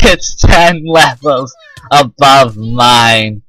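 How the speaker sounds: loud laugh running into drawn-out words; slow, incredulous delivery with real laughter. Real, not acted. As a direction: laugh helplessly through the words. Laughs at hopelessly stronger opponent.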